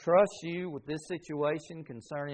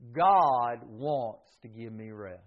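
slow, deliberate affirmation; slow declaration; 7 semitones lower, slower than usual. Shown as a demonstration. Preacher leading the congregation, slow and deliberate. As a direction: say it slowly, word by word. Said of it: "Would you say that with me?".

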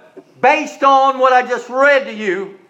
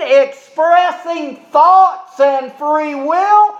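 high-pitched, drawn-out rhetorical questions; impassioned preaching; 6 semitones higher, slower than usual. Real, not acted. Preacher warns congregation about schooling, impassioned and high-pitched. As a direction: preach it with rising force, stretching each question.

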